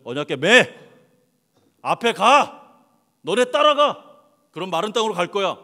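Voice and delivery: God ordering the priests forward across the river; shouted command. Acted.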